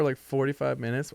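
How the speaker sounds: usual speaking voice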